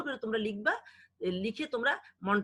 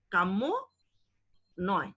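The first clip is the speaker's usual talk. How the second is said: ordinary talk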